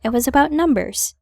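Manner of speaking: ordinary delivery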